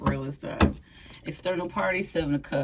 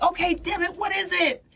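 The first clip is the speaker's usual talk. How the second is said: exasperated; 3 dB louder than usual. Pretend. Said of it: "And you're like".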